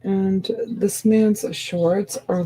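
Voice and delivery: ordinary delivery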